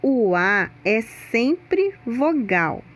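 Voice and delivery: slowly, leaning on "sempre"; teacher stressing the rule, slow; slow emphatic rule. Shown as a demonstration. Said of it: "Atenção agora pra regrinha".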